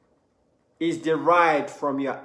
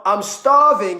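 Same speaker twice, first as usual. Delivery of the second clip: emphatic starving; 3 semitones higher, 6 dB louder, slower than usual. Shown as a demonstration. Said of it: "is different to".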